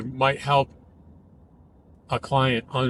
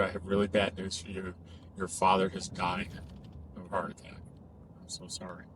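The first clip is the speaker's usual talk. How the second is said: breaking bad news; 2 semitones lower, 6 dB quieter than usual. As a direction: deliver bad news gently, trailing down very quiet. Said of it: "a person that tells you".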